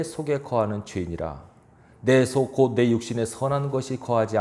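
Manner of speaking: measured, solemn Bible reading; scripture reading. Acted. Reads Romans aloud. (성경 봉독하듯 차분하고 엄숙하게)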